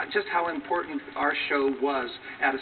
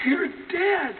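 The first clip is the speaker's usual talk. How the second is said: much higher, mock tearful "You're dead"; imitating a sobbing fan, pitched up high; weeping fan. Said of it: "And she said".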